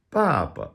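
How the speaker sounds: BABA high first syllable, second drops off; neutral-tone ending. Shown as a demonstration. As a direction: let the last BA of BABA fall away toneless. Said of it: "Some other examples".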